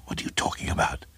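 quick stammered whisper; baffled whisper. A narrator's reading. Craven to his babbling neighbour, quick baffled whisper. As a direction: whisper it fast, tripping at the start.

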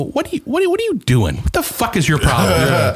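mock outburst that starts high; angry retelling. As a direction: voice jumps up in indignation. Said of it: "when I'm angry for some reason".

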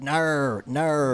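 mock Aussie "no", said as "nar"; imitating Australians saying no; Australian accent. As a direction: exaggerate an Australian "no" with an r-coloured ending. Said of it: "You're not saying no like we said no".

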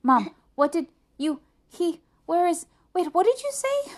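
high, loud, broken starts climbing in pitch; flustered stammer. A narrator's reading. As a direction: stumble over the question in a panic. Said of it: "Arthur stammered".

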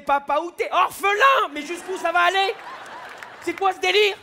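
high, loud, building outrage; escalating rant. Acted. Rising comic rant on [name].

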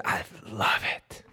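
low, breathy half-whispered rave; acted awed whisper, drawn out; hushed delight. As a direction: savour it slowly under your breath. Delighted reply to pitched stage name.

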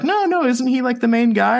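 high, questioning imitation of a puzzled customer-facing guy; retold doubtful bartender. Bartender questions a guest's correction about an actor, mock doubtful.

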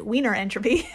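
"Wiener" said as "weener" with an American R, in an American accent; plain American accent, "weener entropy". Shown as a demonstration. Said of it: "in an American accent".

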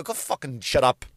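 normal talking voice

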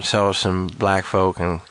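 put-on low, slow Southern accent; put-on low Southern drawl; Tennessee Southern. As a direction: drag it out in deep Tennessee. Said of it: "Tennessee Southern".

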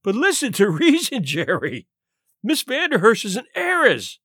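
urgent pleading. A narrator's reading. Tall man begs Murray to see reason, pleading.

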